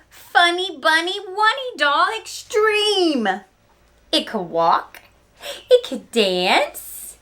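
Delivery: big, loud build to the doll's name; excited storytelling. Acted. Teacher telling kids about Trixie's new doll, excited storytelling. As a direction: sell the new toy like a thrilling surprise. Narrates the surprise gift.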